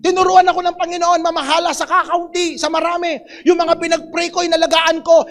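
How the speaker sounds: normal talking voice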